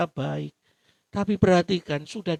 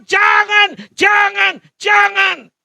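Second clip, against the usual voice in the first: loud, high shouted jangan; God shouting; 13 semitones higher, 13 dB louder, much slower than usual. Acted. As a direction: thunder out each jangan as God. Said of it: "Makanya Tuhan bilang". (Tuhan berseru jangan dengan lantang)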